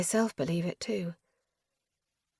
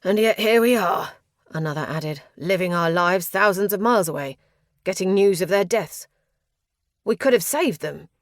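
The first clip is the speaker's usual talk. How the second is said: angry; 6 dB louder than usual. A narrator's reading. Angry refugee accuses.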